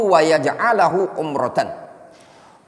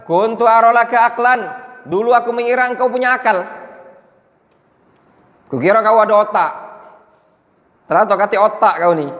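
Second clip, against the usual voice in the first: scornful rebuke; 5 semitones higher, 4 dB louder than usual. Acted. Imam Ahmad rebukes Salamah.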